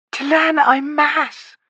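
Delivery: high, slow, reedy murmur; reedy, thin voice. A narrator's reading. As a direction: breathe the words in thin, reedy wonder. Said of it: "whispered in a reedy, thin voice".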